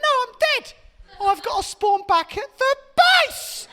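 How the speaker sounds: put-on high, loud, slow kid insisting he's dead; kid yelling. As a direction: whine it like a gamer kid. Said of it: "And he went".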